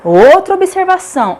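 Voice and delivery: normal talking voice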